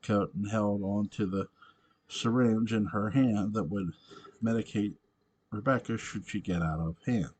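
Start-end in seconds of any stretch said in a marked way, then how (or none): none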